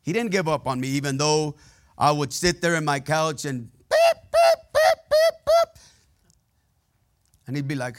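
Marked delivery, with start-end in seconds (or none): 3.7-5.8 loud, very high repeated beeps; piercingly high mock bleeping; imitated censor beeps. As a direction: mimic a string of censor tones. Imitates bleeped-out cursing on couch.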